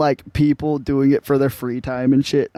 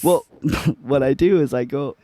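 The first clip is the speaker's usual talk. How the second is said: ordinary talk, nothing marked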